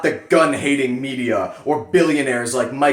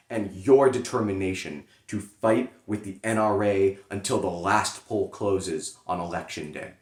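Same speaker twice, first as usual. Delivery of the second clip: softer, lower sincere close; earnest postscript; 7 semitones lower, 7 dB quieter than usual. Acted. Closing the letter to members, soft and earnest. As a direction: drop down and speak it from the heart.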